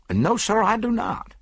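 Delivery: high, firm emphatic denial; an emphatic doctor's no. A narrator's reading. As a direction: declare it flat out. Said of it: "declared the doctor emphatically".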